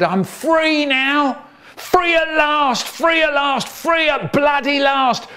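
overjoyed and near tears; ecstatic shout. Acted. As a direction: howl it out like a released prisoner. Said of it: "he said, and started crying".

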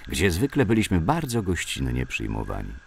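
usual speaking voice

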